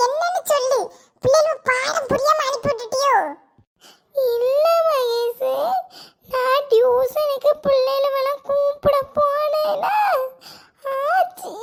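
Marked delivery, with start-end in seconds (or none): 4.2-11.5 slow, whimpering drawl through tears; tearful explaining. Acted. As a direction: sob out the explanation bit by bit. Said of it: "எதுக்குமா அழுக".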